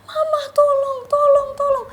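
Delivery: high, loud, desperate cry for help; panicked plea to mom. Retold or imitated. Calling her mother in panic, loud high crying plea. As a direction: scream down the phone to mama.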